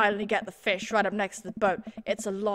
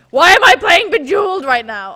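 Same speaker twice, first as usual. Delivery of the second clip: loud, higher exasperated cry; exasperated shout; 7 semitones higher, 16 dB louder than usual. Real, not acted. Streamer asking himself about Bejeweled, exasperated shout. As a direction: wail at the absurdity. Frustrated outburst at minigame.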